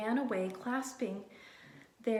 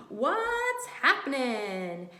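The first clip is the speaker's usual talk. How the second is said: loud, slow booming question; Tacky blaring a friendly greeting; 3 semitones higher, 5 dB louder, slower than usual. A narrator's reading. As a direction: blare it out cheerfully. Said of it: "blared Tacky".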